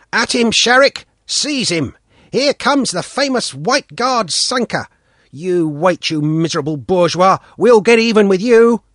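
put-on loud jeering boy's voice; taunting boy. A narrator's reading. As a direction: egg the dog on, mocking. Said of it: "and said".

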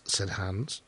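usual speaking voice

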